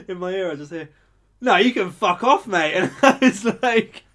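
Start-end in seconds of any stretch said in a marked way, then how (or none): 1.4-2.7 loud, high mock outburst; retold belligerent yell; imitated angry customer. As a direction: act out a customer kicking off.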